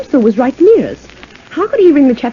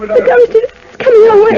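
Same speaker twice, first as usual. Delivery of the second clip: frightened; 9 semitones higher than usual. Acted. Ghost rising toward them.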